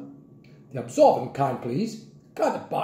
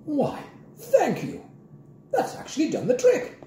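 chilly tone; 12 semitones higher than usual. A narrator's reading. Mrs Trifle answering Grandpa after a silence, chilly. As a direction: thank him with frosty poise. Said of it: "announced in a chilly tone".

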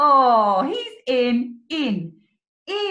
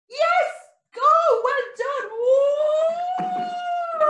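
high, loud whoop of delight; excited cheer; 13 semitones higher, 4 dB louder, much slower than usual. Acted. Teacher cheers the child as the spider drops in, high and loud. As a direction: cheer the child on like they scored.

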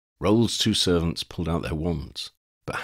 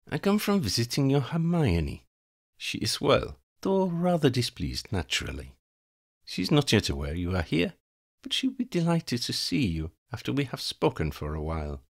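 higher, silky countess voice; haughty vampire countess; 6 semitones higher than usual. Acted. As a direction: play the vampire countess, composed and cool.

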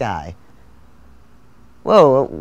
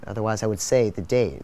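ordinary delivery

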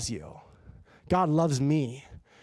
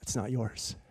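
low and much softer, calm and tender; soft gentle voice; 4 semitones lower, 8 dB quieter than usual. Retold or imitated. God gently telling the driver the money isn't his, soft and low. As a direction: say it like a gentle whisper of correction. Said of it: "that soft, gentle voice".

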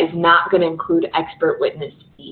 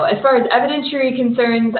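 rattled off like an auctioneer, higher; auctioneer talk; 6 semitones higher than usual. Shown as a demonstration. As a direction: rush it like an auctioneer. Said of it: "We're gonna talk like an auctioneer".

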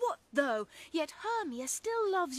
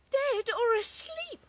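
usual speaking voice

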